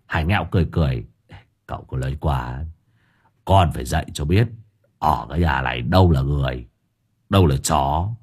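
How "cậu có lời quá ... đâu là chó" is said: low, wry servant's reply; low male character voice. A narrator's reading. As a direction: answer with a sly grin in the voice. Hải Nghẹo's low voice. (giọng trầm, cười cười)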